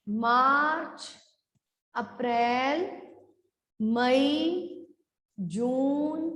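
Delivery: slow drawn-out recitation, each item rising; list read-aloud. Shown as a demonstration. Teacher reading all the months to pupils, slow measured list. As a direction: stretch each month and pause after it. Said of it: "एक बार फिर से पढ़ते हैं".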